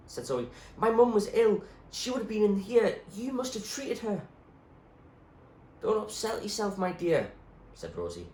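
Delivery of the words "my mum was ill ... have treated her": put-on higher, louder urgent girl; pleading girl. Zoe begging the doctor to remember, higher urgent voice. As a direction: insist desperately as Zoe.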